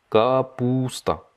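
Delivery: капуста said slowly and clearly; slow teaching repeat. Shown as a demonstration. Teacher slowing капуста down for learners. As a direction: draw out капуста. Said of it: "slow repeat of vocabulary".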